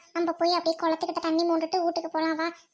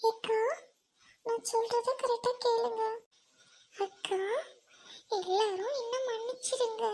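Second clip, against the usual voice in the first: higher, subdued, level voice; hushed, defeated confession; sorrowful apology; 7 semitones higher than usual. Acted. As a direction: beg forgiveness, feeling unworthy. Girl apologizes, calls herself unworthy.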